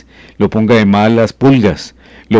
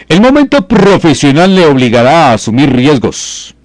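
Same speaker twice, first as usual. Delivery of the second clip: high, bright announcer read of the forecast; lifted, presenter-style horoscope announcement; 6 semitones higher, 4 dB louder than usual. Acted. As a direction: read the sign like a radio astrologer. Reads the forecast line aloud.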